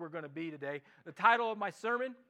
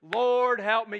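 cry out loud; 2 semitones higher, 11 dB louder, slower than usual. Shown as a demonstration. Pastor leads the congregation to cry out loud. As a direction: call Lord, help me out loud with the room. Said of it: "Just say that with me out loud".

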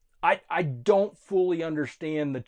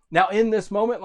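preaching; 5 semitones higher, 9 dB louder than usual. Real, not acted. Preaching at full force.

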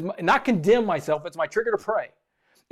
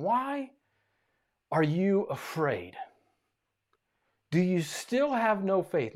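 gentle rebuke; 9 dB quieter than usual. Retold or imitated. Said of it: "he asked them".

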